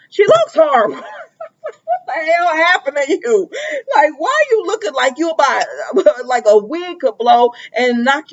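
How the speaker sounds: high-pitched, breaking into laughter; amused, incredulous squeal; laughing disbelief. Real, not acted. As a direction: crack up while exclaiming in disbelief. Laughing at Willow's sickly looks.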